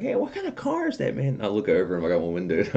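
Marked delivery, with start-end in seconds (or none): 0.0-1.3 high mock kid voice; imitating kid. Retelling the kid at the light, high mock voice. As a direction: squeak it like the curious kid. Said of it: "this kid's like".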